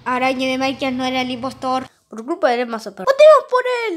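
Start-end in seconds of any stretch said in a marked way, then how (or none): 3.1-4.0 high, loud rallying call; rally everyone to vote; excited shout. Pretend. Rallying votes in a meeting. (grito animado para votar)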